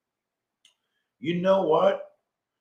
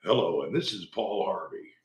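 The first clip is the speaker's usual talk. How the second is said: lower, softer radio-host voice, lightly done; a hint of an old radio broadcaster; Paul Harvey impression; 6 semitones lower, 6 dB quieter than usual. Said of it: "do a debate with Paul Harvey".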